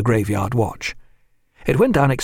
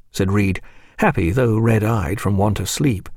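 usual speaking voice

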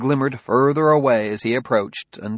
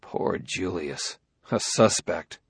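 quiet inner thought; 5 semitones lower, 6 dB quieter, slower than usual. Acted. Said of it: "He thought".

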